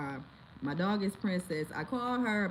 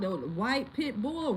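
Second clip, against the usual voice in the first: ordinary talk, nothing marked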